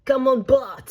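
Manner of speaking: badly faked French accent, called out loudly. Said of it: "That was not a good French accent".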